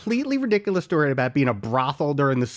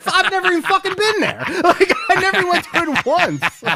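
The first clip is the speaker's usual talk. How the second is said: real laughter, voice pitched much higher and louder; laughing; 12 semitones higher, 5 dB louder than usual. Real, not acted. Punchline about never visiting Friendlies, laughing hard. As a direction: crack up while admitting it. Laughing at his own joke.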